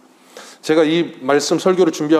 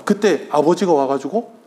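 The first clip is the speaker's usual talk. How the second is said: ordinary talk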